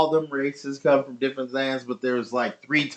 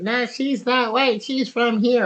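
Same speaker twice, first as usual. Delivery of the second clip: higher mocking voice of the arguing cousin; 11 semitones higher than usual. Retelling the cousin's argument, higher mocking voice. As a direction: mimic her denial, pitched up. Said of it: "And she's like".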